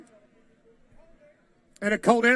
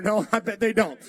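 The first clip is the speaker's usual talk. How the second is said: normal talking voice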